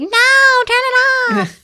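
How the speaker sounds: high-pitched imitation of a scared kid; hammed-up kid's plea; mock child's protest. Retells child reacting to scary film.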